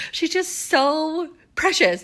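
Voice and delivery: high, slow, doting voice; cutesy gush. Acted. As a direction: coo it like praising a sweet little thing. Gushing over sweet friend.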